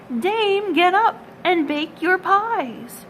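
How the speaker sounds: high, loud, slow rooster cry; cock crowing call. A narrator's reading. Cocks crying out to wake the dame, high and loud. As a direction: crow it out like a cock at dawn.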